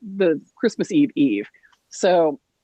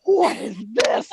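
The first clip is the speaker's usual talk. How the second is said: big gasp, then slow, aghast question; drawn-out theatrical shock; mock horrified discovery; 3 semitones higher, slower than usual. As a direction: gasp and wail it in hammed-up betrayal. Acts out horror at finding box.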